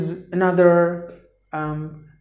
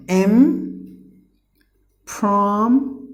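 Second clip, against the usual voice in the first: "im, prom, tu" raised and widely separated; syllable by syllable; 3 semitones higher, much slower than usual. Shown as a demonstration. Teacher breaking impromptu into three, separated syllables. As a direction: sound out each syllable with long pauses. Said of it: "There are three syllables".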